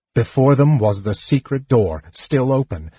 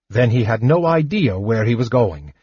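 plain reading voice

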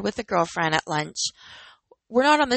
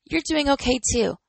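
smiling voice; 7 semitones higher than usual. Real, not acted. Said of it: "you can hear the smile in my voice".

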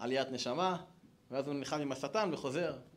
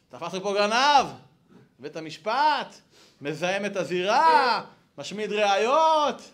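loud accusing announcement, stretched final words; mock alarm shout; 4 semitones higher, 11 dB louder, slower than usual. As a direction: report a criminal to headquarters, hammed-up. Angel reports thief over intercom.

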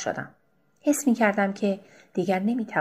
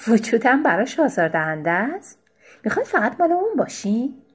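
higher, louder angry questions; 4 semitones higher, 3 dB louder than usual. Acted. Wife, angry again, attacks the other woman to her husband. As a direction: lash out in jealous anger. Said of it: "باز خشم گریبانم را گرفت".

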